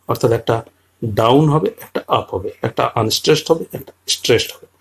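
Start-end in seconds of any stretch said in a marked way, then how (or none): none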